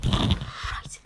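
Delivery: breathless whispered swear; whispered curse. Real, not acted. After the sting, whispering a curse to himself. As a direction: hiss the curse under your breath.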